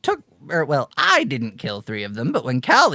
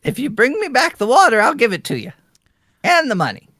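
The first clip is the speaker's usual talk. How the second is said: high-voiced old woman; higher-pitched grandma offering a bargain; old woman host; 7 semitones higher, much faster than usual.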